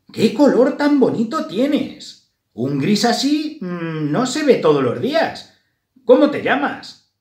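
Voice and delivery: high, loud, friendly mouse voice; admiring mouse. A narrator's reading. Another mouse admires Grisela's grey and asks her name, admiring. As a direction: pipe up with delighted interest.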